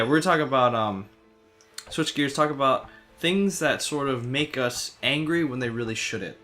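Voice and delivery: usual speaking voice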